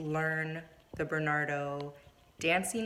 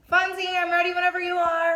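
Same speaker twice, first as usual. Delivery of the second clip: high, louder call across the room; 13 semitones higher, 6 dB louder than usual. Real, not acted. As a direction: call out to someone nearby. Calling the cat camera crew.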